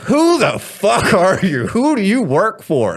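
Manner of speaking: slow, loud, high threatening demand; drawn-out thug shouting at a captive; menacing demand. Retold or imitated. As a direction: interrogate him like a thug. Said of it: "they're just like".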